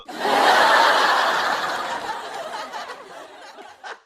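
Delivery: long, high, breathy laughter; loud laugh. Real, not acted. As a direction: laugh it off, cackling. Laughs after sarcastic question.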